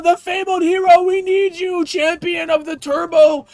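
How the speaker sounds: mock the game hailing you as the hero; hammed-up epic herald. Streamer mocking retail NPCs, in a slow, hammed-up shouted proclamation. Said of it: "they're like".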